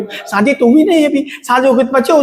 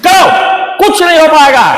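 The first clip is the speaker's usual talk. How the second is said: loud, exasperated shout; angry dismissal; 4 semitones higher, 5 dB louder than usual. Acted. Teacher giving up on his mocking students, angry dismissal. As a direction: write them off at full volume.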